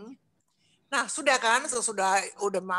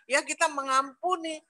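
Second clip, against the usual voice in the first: ordinary talk, nothing marked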